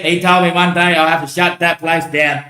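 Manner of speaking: usual speaking voice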